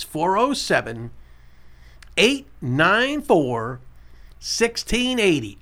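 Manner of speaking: slow phone-number read-out; slow, spaced digits for callers to catch; said slowly. Shown as a demonstration. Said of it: "I'll say it slow".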